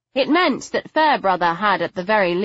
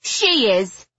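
spitting it out, higher; spat out; 5 semitones higher than usual. Acted. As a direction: spit the words with venom. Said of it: "Figgy fairly spat".

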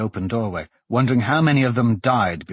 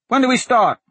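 put-on high, louder boy's voice; eager boy; 6 dB louder than usual. A narrator's reading. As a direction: pipe up high like an eager lad. Young boy answers the men.

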